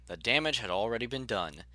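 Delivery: ordinary delivery, nothing marked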